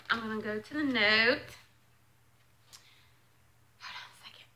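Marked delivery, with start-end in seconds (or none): 3.8-4.5 quick whispered aside; whispering. Real, not acted. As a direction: breathe it to yourself. Aside while opening note.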